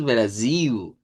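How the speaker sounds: "Brasil" with the final L said as U, "Brasiu"; Portuguese "Brasil" with a U ending; Brazilian Brasil. Shown as a demonstration. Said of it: "con acento portugués de Brasil".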